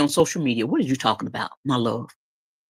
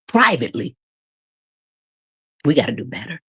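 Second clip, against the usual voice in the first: ordinary talk, nothing marked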